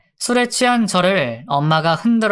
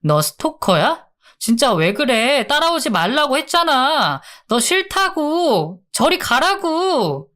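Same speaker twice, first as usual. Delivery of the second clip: exasperated acted yell, climbing much higher; narrated shout; 8 semitones higher than usual. A narrator's reading. Woman orders the man following her away, acted shout. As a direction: pitch up and up, stretching the last refusals. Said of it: "고함을 질렀죠".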